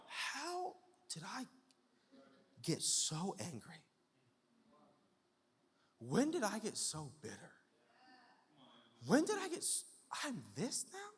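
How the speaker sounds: soft, high-pitched, halting disbelief; bewildered dismay. Acted. Person waking up asking how they got bitter, bewildered dismay. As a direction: ask it softly, in acted bewilderment. Acting someone waking up to ruin.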